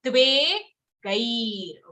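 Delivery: यो, द्वे, Gair one at a time with pauses; slow demonstration. Shown as a demonstration. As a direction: break it into slow separate syllables. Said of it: "so say this slowly".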